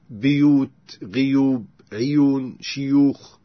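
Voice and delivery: بيوت، غيوب، عيون، شيوخ opening with "i"; "biyoot, ghiyoob, iyoon, shiyookh" for بيوت، غيوب، عيون، شيوخ; i instead of u starting بيوت، غيوب، عيون، شيوخ; kasra on first letter. Shown as a demonstration. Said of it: "يكسر هذه الكلمات".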